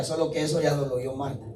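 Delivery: normal talking voice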